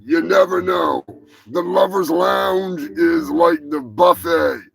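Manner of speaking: hammed-up commercial announcer voice; mock ad read. Host reads the show's fake sponsor ads in a hammed-up ad voice. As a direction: sell the sponsors like a cheesy ad.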